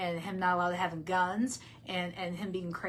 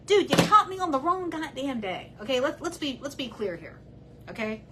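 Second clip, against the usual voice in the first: heated outburst; 5 semitones higher than usual. Real, not acted. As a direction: let the anger boil over at him. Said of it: "I'm already emotional".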